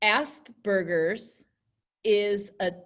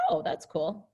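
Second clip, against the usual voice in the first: interested; 5 semitones lower, 3 dB quieter than usual. Shown as a demonstration. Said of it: "She's interested".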